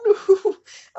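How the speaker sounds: loud high laugh; burst of laughter. Real, not acted. Laughing at the fic's line to viewers, loud laugh. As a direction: crack up at the line.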